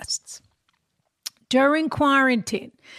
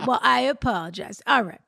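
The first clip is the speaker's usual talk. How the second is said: normal talking voice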